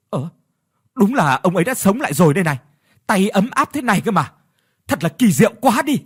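fast, high, loud marvelling; amazed exclamation. A narrator's reading. Villagers touch the old man's warm hand, exclaiming in amazement. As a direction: blurt it out in wonder. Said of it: "thốt lên".

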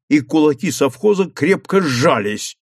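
plain reading voice

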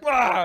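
high loud yell; battle yell. Retold or imitated. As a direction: shout Wah as you fire. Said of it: "So you're just like".

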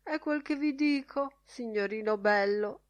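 high, loud, whiny grumble; querulous whining. A narrator's reading. Mulligan moaning to Stephen about the long wait, querulous whining. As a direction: whimper out a complaint in querulous dialect. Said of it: "piagnucolò in querulo dialetto".

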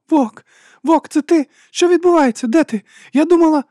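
very high, loud, distraught questions; tearful. A narrator's reading. Juliette reaching Walker over the radio, crying. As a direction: cry out through tears, pitch way up. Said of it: "долинули плач і схлипування".

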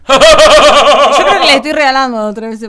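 laughing. Real, not acted. Laughing through her answer.

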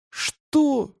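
high, outraged question; indignant outburst, pitch leaping up. A narrator's reading. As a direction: exclaim in sheer indignation. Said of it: "возмутился Паша".

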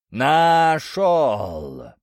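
loud, long-drawn giant's shout; крик великана. A narrator's reading. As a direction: stretch both syllables in a giant's bellow. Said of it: "снова выкрикнул великан". (протяжный крик великана)

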